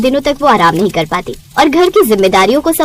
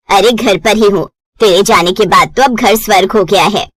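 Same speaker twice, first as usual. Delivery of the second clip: lower, knowing mother's warning; mother warns daughter she'll come running back, lower voice; 5 semitones lower than usual. Acted. Mother on phone.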